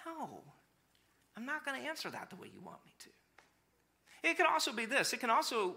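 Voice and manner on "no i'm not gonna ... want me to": soft, calm, firm no; quiet refusal. Retold or imitated. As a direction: gently turn the request down as God. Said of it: "God just looks at that and just goes".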